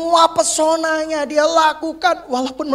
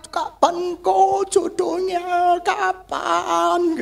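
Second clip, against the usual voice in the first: whining single; 6 semitones higher, much slower than usual. Retold or imitated. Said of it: "pengen didoain sama saya".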